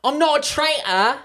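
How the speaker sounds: high, drawn-out mock British accent. Joking about Traitors accents to co-host, mock British accent. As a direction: put on an exaggerated British accent. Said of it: "That was the British one I was doing".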